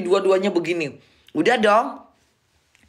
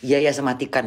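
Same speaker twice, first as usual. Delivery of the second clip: passenger's sheepish reply; 7 semitones lower than usual. Retold or imitated.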